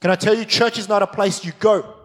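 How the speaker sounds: ordinary delivery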